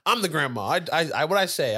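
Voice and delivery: a grandma pulling rank, sputtering; flustered grandma. Retold or imitated.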